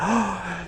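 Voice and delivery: sharp high surprised gasp. Retold or imitated. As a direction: gasp in amazement like the old lady. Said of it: "she went".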